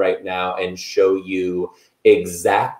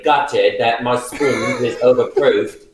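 mock British baking show voice; British accent; 3 semitones higher than usual. Host shows off British accent from Bake Off. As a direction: play up the posh Bake Off Brit. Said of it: "my British accent is all inspired".

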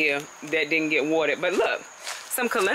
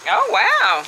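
high, loud oh wow of disgust; loud startled oh wow; shocked oh wow; 5 dB louder than usual. Real, not acted. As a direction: recoil at what you just pulled up. Pulling up a rotten potato.

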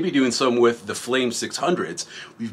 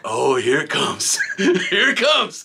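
slow mock dread; 2 semitones higher, slower than usual. Bracing for a fight.

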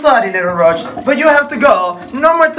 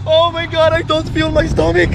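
normal talking voice

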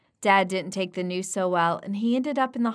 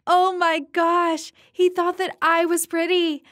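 high, louder gushing swoon; giddy swoon; 8 semitones higher, 4 dB louder than usual. Acted. Narrator gushing after her crush calls her pretty, giddy and high. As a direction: squeal it like a smitten girl. Swooning over his compliment.

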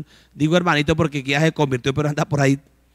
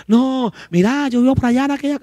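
voicing a poor neighbour; 7 semitones higher than usual. Preacher acting a poor neighbour telling where she lives, high and humble. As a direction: answer shyly like a poor host. Poor person answering the visitor.

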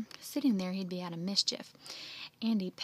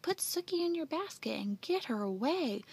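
urgent, higher-pitched instruction; crying out; 6 semitones higher than usual. Acted. Jeff begging Andy to take the pig, crying out urgently. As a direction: push him to act right now. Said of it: "Jeff cried".

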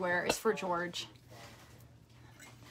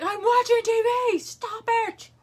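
mock yell; 12 semitones higher, 6 dB louder than usual. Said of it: "he just started screaming at me".

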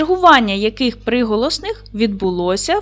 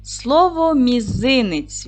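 "мізинець" said very slowly, each syllable stretched; teacher stretching "мізинець" for the class; drawn-out reveal; 3 semitones higher, much slower than usual. Shown as a demonstration. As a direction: unveil "мізинець" slowly like a surprise. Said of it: "reveals rebus answer, drawn out".

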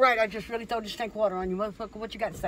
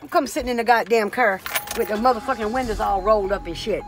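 ordinary talk

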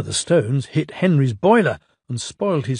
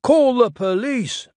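higher, mildly cross call; angry shout; 10 semitones higher than usual. Acted. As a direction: demand it crossly. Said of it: "they shouted angrily".

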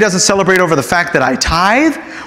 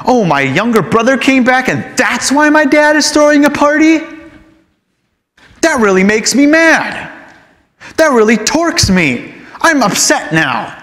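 enraged; 6 semitones higher than usual. Acted. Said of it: "He becomes enraged".

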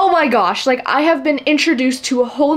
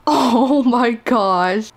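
teary awe; much slower than usual. Real, not acted. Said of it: "Why am I tearing up?".

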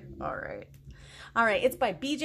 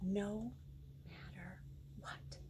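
whisper; 6 semitones lower, 17 dB quieter, slower than usual. Acted. Dramatic hush in rule.

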